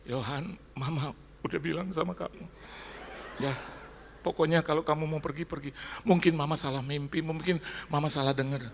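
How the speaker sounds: a hint of a mother's high voice; imitated mother. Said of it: "Mama saya bilang".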